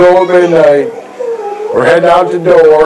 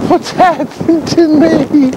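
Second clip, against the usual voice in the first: high, slow mock wail; hammed-up despair; 10 semitones higher, slower than usual. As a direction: ham up the tragedy.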